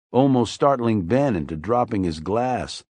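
plain reading voice

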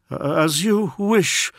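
high, slow, solemn assent; bow to his lord's will; devoted courtier. Acted.

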